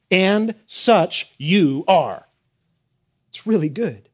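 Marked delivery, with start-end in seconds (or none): 0.1-2.3 AND SUCH YOU ARE drawn out very slowly, word by word; strong emphasis. Shown as a demonstration. Preacher showing the verse's emphasis, "And such you are" slow and weighty. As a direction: land hard and separately on each word of "And such you are". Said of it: "And here's the emphasis".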